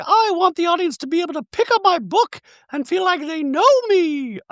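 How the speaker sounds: very high-pitched mock-chirpy caricature; ditzy reality-star send-up, far above normal pitch; Dorit. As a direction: squeal it out in a silly falsetto. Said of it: "But she's like".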